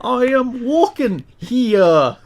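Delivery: mock New York fantasy voice. Riffing on the bad accent, slow high mock New York voice. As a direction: drag it out like a medieval New Yorker. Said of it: "a New York accent".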